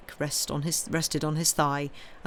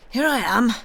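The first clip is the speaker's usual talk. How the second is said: loud, higher-pitched astonished call; 6 semitones higher, 8 dB louder than usual. A narrator's reading. Innkeeper greeting an unexpected caller, astonished. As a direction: call out in startled surprise. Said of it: "Caderousse said in astonishment".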